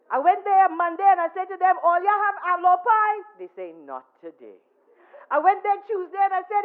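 high, loud retelling of the man; loud, high character voice telling the story; loud impersonation. Retold or imitated. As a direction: hold his booming voice all through. Man's story told in his voice.

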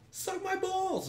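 high-pitched exasperated jab; exasperated outburst. Real, not acted. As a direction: pitch it way up in real frustration. Frustrated at game's contract demands.

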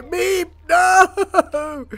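high, loud despairing wail; anguished cry. Real, not acted. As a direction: cry out as he dies. Crying out as colonist dies.

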